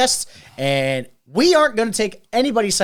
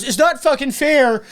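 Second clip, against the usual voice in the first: high, slow, stretched-out complaint; mock whining protest; 8 semitones higher, 4 dB louder, slower than usual. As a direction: imitate a sore loser wailing unfairness. Mimics kicked-out customer protesting.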